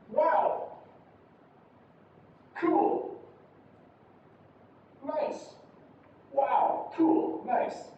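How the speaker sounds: "Wow, cool, nice" all pitched very high; high intonation. Shown as a demonstration. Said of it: "It's gonna be a very high one".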